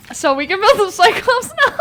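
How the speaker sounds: high, loud, laughing shout; thrilled yell breaking into laughter; excited. Real, not acted. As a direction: burst out with delight. Excited about the new blueprint.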